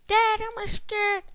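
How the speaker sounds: put-on a high, slow little-kid voice; mock scared kid. Gamer voices a scared kid calling to dad, high and slow. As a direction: whimper it like a frightened child.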